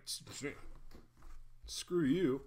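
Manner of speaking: stammered mock outrage, screw you; mock retort. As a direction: play a mock offended snap at a rude stranger. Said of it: "you're like".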